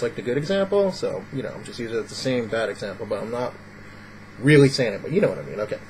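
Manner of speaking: normal talking voice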